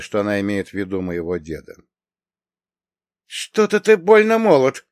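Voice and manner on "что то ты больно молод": high, loud, doubting remark; openly doubtful woman's voice; skeptical huff. A narrator's reading. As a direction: challenge him in a high, raised voice. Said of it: "хмыкнула она".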